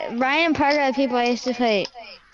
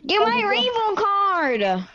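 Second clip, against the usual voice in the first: urgent call; 9 semitones higher, much slower than usual. Real, not acted. Urgent call during game fight.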